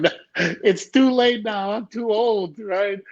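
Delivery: high-pitched, drawn-out objection; reenacting a slow, incredulous no; retold dismissive protest. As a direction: wave the idea away in a high voice. Retells brushing off mother's suggestion.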